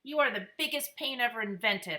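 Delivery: loud angry shout at a little brother; fed-up big brother yelling; yelled. A narrator's reading. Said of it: "I yelled".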